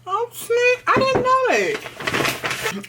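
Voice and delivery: high, slow surprised voice; surprised squeal. Real, not acted. Surprised finding glass bottle.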